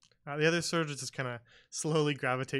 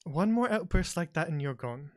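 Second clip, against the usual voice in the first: stern warning; 5 semitones higher, 6 dB louder than usual. Acted. Lucilla warns the waiter in character.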